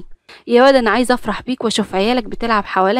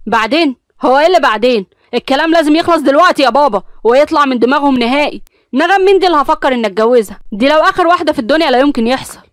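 loud, higher angry insistence; 4 semitones higher, 4 dB louder than usual. Acted. Son angrily insisting to his father, loud. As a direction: demand it end right now. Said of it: "أمير بعصبية قال".

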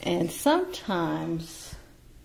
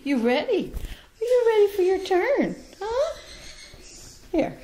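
much higher, sing-song coaxing voice to a bird; high pet talk; 13 semitones higher than usual. Real, not acted. Owner coaxing her parrot Beauty to step up, high pet talk. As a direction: coo up at a little parrot.

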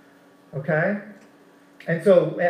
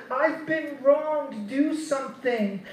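mock victim whine; 6 semitones higher, 5 dB quieter, slower than usual. Mocking a victim's complaint.

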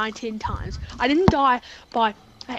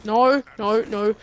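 ordinary delivery

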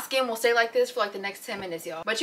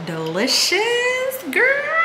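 high squeal; much slower than usual. Real, not acted. Vlogger squeals over her plate, very high.

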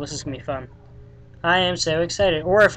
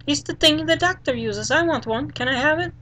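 put-on higher kid voice, wanting the toy; whiny little boy; 10 semitones higher than usual. Fluffy asks David for the doctor's tool, pleading kid voice. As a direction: beg like a small child. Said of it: "do, like, a little voice".

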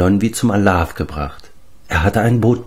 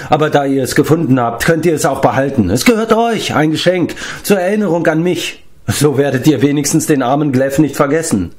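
self-pitying giant; 7 semitones higher than usual. Acted.

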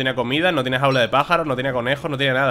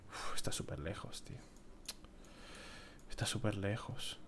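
whisper; 4 semitones lower, 20 dB quieter than usual. Real, not acted. Gamer whispering to himself at how far it is, whispered. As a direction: hush it, as if the distance hurts.

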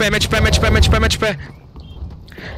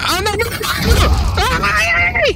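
panicked cry; 10 semitones higher, slower than usual. Real, not acted. Taking hits, crying out.

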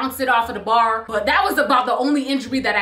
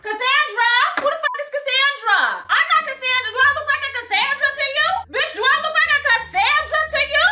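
much higher, laughing outraged yell; outraged shout; 11 semitones higher than usual. Retold or imitated. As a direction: scream it back, outraged and amused. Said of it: "outraged at wrong name".

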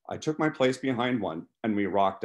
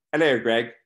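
put-on British-accented greeting, a little louder; 3 semitones higher, 6 dB louder than usual. A narrator's reading. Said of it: "said with a British accent".

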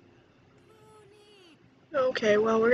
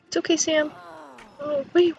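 ordinary talk, nothing marked

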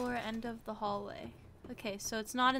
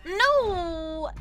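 emphatic no; 6 semitones higher, 11 dB louder than usual. Real, not acted. Refusing to enter moon pool.

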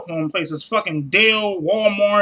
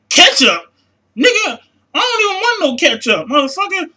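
higher, louder cry of disbelief; incredulous outburst; 7 semitones higher, 3 dB louder than usual. Real, not acted. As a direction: fire it back in outraged confusion. Incredulous at the rep's word.

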